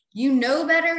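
emphatic scolding. Retold or imitated. Scolding repeat, louder.

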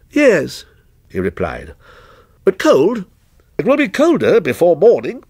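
lightly voiced stranger, detached and ominous; stranger's voice. Lightly voiced stranger answering the night watchman, a touch aloof.

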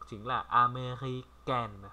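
American said very slowly, each syllable clear; careful pronunciation. Shown as a demonstration. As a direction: stretch out American. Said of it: "Phát âm khác hẳn nhá".

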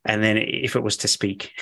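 ordinary talk

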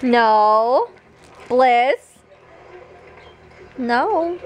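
normal talking voice

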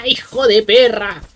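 angry yell. Real, not acted. Startled by enemy.

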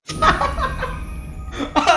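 high-pitched helpless laughter. Real, not acted. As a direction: laugh at your own disaster. Laughs at losing first match. (ऊँची, बेबस हँसी)

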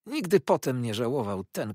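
ordinary delivery, nothing marked

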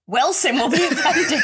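laughs through the joke about Wilson; laughing. Real, not acted. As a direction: giggle while you say it, pitch rising.

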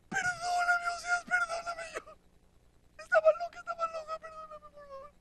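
very high, soft mock weeping; tearful begging. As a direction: whimper an apology, fading away. Gomer suplica perdón llorando. (llanto suplicante agudo, exagerado)

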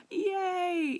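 high, loud squealed yay; excited cheer. Retold or imitated. Viewer reacting to the changing of the calendar, excited cheer. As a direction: squeal it out with glee.

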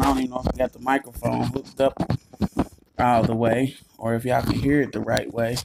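ordinary delivery

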